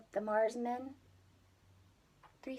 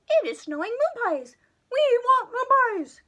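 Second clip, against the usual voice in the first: much higher, fast squeaky little voices; Marsmen calling out; 12 semitones higher, 11 dB louder than usual. Acted. Marsmen calling out to Jimmy in high squeaky voices. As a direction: chirp it out like tiny aliens. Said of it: "they called out together".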